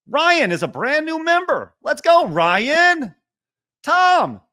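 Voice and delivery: high, loud, slow excited callout; drawn out hype cheer; hype shout. Real, not acted. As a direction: cheer the new member on. Hyping a new member.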